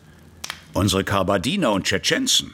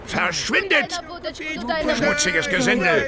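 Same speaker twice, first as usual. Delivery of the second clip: angry shout. Acted. Officer shoos away locals.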